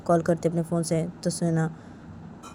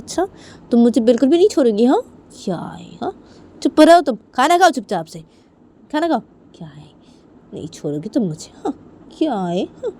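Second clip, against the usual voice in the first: scolding father; 6 semitones higher, 8 dB louder than usual. Acted. (डाँटते हुए पापा)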